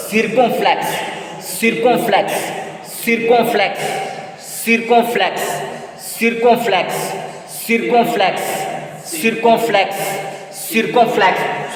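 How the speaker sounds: "Circumflex" said the French way, "seer-kon-flex"; "Circumflex" with ee and nasal o; French pronunciation drill. Shown as a demonstration. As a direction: repeat "Circumflex" as French, evenly for learners. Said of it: "In French".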